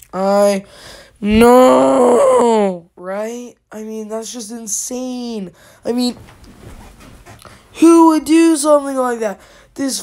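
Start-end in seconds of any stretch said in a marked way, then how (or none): 0.1-2.9 loud, long-drawn wail; hammed-up cry of horror, stretched out; dramatic disbelief. Dramatic reaction to officer being shot. 7.7-9.4 loud, high incredulous question; aghast, voice raised; outraged disbelief. Acted. Outraged at the kid's shooting.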